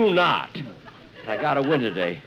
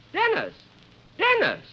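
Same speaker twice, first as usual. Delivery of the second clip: calling out. Acted. Spotting Dennis in the crowd.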